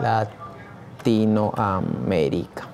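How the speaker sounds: very slow, stretched syllables; drawn-out emphasis. Real, not acted. Teacher naming his audience of professors, slowly drawn out. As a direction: draw the name out syllable by syllable. Name repeated, drawn out for effect.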